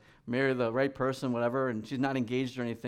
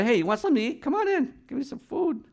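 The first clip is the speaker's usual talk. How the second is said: hospitable woman; 11 semitones higher, 3 dB louder than usual. Retold or imitated.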